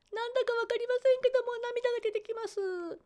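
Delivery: 高く細い声で戸惑う生徒; 涙ぐむ受講生を軽く演じて; 泣きながら話す生徒. Retold or imitated. 泣く受講生の声を再現. (高い声で軽く演じる泣く受講生)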